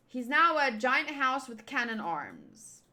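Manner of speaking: ordinary talk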